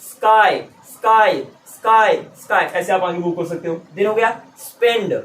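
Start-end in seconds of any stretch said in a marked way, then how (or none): 0.0-2.6 "स्काई" drilled from hissed s to quick "skai"; s getting shorter. Shown as a demonstration. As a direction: repeat "स्काई" firmly, shrinking the s. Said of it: "सांस बाहर निकाला फिर काई". ("स्काई" में सांस वाला स, घटता हुआ)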